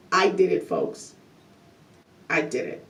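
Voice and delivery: low, hushed mock Trump boast; a hint of Trump bragging quietly from prison; Trump impression. Host mimics Trump bragging from prison, low and hushed. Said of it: "I can't even do him".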